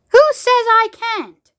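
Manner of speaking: high, slow, loud challenge; defiant shout. Acted. Veruca defying Wonka, slow high shout. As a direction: yell it back in defiance.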